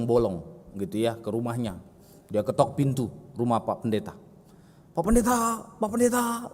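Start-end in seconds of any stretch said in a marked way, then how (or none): 4.9-6.5 higher, lightly voiced little kid calling at the door; a child knocking and calling for the pastor. A child at the door calls for the pastor, high and eager.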